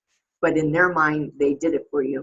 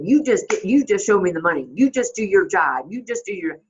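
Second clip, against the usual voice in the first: higher, louder mock demands; combative; 3 semitones higher, 4 dB louder than usual. Combative football player demanding of his agent, mock confrontational. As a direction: push each demand out combatively. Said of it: "he's very combative".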